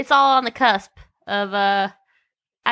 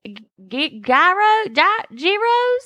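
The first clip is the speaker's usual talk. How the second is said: country ordering voice; 6 semitones higher, much slower than usual. Retold or imitated. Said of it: "countryest ever".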